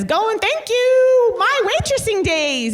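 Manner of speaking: high, slow mock toast; a light impression of Dorinda toasting; imitating Dorinda. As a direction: raise a glass in a high imitated voice. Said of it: "and she says".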